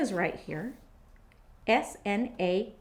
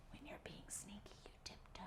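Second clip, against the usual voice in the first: sneaky whisper; 26 dB quieter, much faster than usual. Shown as a demonstration. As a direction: whisper like tiptoeing. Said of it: "Sometimes you walk quietly".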